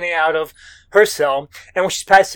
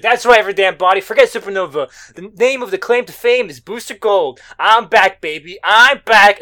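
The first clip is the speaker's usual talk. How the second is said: loud, raised-pitch hero's proclamation; triumphant boast; 3 semitones higher, 8 dB louder than usual. Retold or imitated. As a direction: announce a big comeback to everybody. Acting hero's triumphant last boast.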